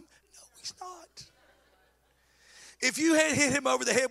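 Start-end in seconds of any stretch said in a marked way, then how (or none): 0.0-1.2 breathy whisper, slow; whispered aside. Real, not acted. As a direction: hiss a quick aside under your breath.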